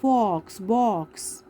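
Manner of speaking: "फॉक्स", "बॉक्स" with a rounded, drawn-out aw; rounded-mouth O. Shown as a demonstration. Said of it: "mouth है वो round हो रहा है".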